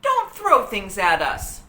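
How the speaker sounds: loud, slow scolding shout. A narrator's reading. Annie shouts at the fruit-throwing monkey. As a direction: yell it at the monkey. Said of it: "shouted Annie".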